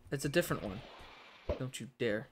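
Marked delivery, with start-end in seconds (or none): none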